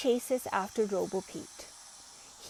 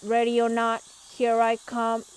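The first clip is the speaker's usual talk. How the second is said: loud call; 2 semitones higher, 7 dB louder than usual. A narrator's reading. Robot seeking.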